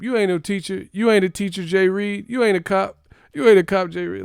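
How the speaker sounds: high, loud indignant ranting at a teacher; mock outraged yelling. Student yelling at his Spanish teacher, mock outrage. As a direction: yell it like you've been cheated by a fake teacher.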